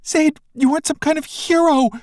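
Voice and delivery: very high, loud scream. Acted. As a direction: yell it at him in fear. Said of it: "she screams".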